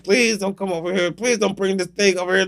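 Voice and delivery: high, hushed plea; hiding and pleading. Acted. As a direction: beg softly in a high voice from a hiding place.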